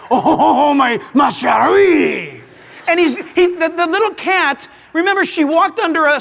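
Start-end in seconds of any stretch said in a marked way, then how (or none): 0.1-2.4 loud, hammed-up French skunk accent; over-the-top French romantic accent; Pepe Le Pew voice. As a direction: ham up a French lover's cry to the cat. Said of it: "he's always talking like this".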